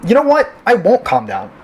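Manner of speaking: high, exasperated snap; fed up, high-pitched challenge; defiant outburst. Acted. Patient refuses to calm down.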